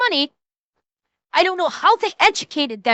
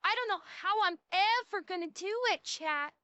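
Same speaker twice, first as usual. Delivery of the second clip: soft, higher-pitched mock begging; mock helpless plea; 4 semitones higher, 6 dB quieter than usual. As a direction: play the helpless victim, very softly. Plays helpless debtor begging chat.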